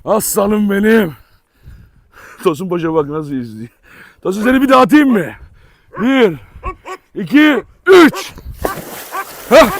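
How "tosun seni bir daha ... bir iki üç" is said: playful countdown. Real, not acted. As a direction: build up the throw like a game. Counting before throwing dog in.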